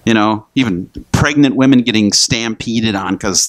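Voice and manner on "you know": ordinary delivery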